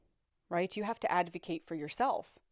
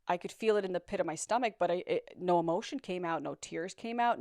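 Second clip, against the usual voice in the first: ordinary delivery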